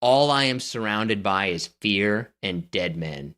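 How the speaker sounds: ordinary talk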